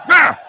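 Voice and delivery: loud high startled cry; startled shout. Real, not acted. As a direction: yelp in surprise. Startled exclamation while watching.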